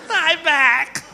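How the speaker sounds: high, laughing repeat; drugged giggly echo. Retold or imitated. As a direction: echo it through doped giggles. Repeats it while acting drugged.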